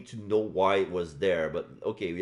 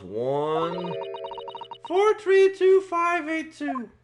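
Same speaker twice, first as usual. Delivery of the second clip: high, slow counting out; high sing-song; much slower than usual. Real, not acted. Entering safe combination.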